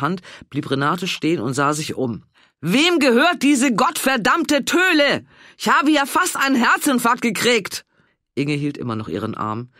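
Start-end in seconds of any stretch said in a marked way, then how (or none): 2.6-7.9 shocked furious outburst. Acted. Renate yells after the startling dog, high and furious. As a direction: rage at the dog that just gave her a fright.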